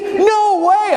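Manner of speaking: high-pitched astonished driver; mock disbelief. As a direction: imitate a driver who can't believe it. Driver's amazed reaction, retold.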